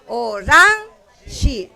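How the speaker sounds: Orange slowly as o-RAAN... shi; drawn-out demonstration of o-RAN-shi for Orange; stress on second syllable. Shown as a demonstration. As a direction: hold the RAN of "Orange", pronounced oranshi. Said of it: "debería jalar el acento".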